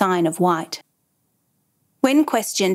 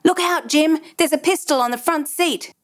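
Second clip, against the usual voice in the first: raised, high-pitched warning cry at a restrained volume; urgent shout; 7 semitones higher than usual. A narrator's reading. Deputy warning his partner of a gun, urgent shout. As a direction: call out a sudden alarm, much higher in pitch. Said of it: "Suddenly he shouted".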